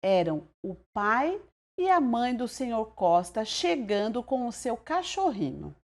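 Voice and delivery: usual speaking voice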